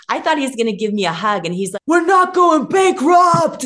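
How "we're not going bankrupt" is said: high, loud, slow shout of relief; excited shout. Retold or imitated. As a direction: yell it out in pure triumph. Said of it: "he was, like, so excited".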